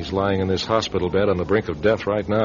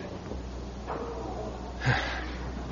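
soft weary sigh; 12 dB quieter than usual. Acted. Sitting by hospital bed.

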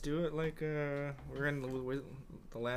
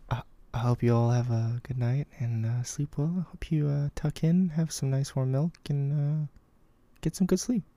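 loud sign-off; 3 semitones higher, 9 dB louder than usual. Real, not acted. Loud goodnight sign-off to listeners.

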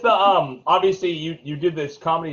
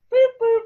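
imitated beeps; 4 dB louder than usual.

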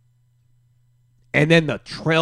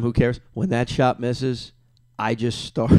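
ordinary delivery

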